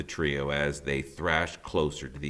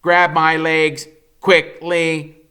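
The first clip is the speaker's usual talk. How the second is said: urgent call; 10 semitones higher, 11 dB louder, slower than usual. A narrator's reading. Rescuing dangling cub.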